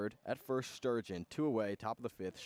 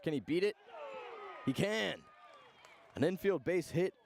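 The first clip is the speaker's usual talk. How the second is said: excited call; 5 semitones higher than usual. Real, not acted. Announcer calling the infield hit, rising excitement. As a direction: call it like the runner just beat the throw. Close play at first base.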